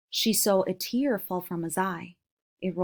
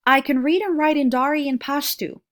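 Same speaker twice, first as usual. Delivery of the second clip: loud; 5 semitones higher, 6 dB louder than usual. Acted. Girl stating her skills loudly.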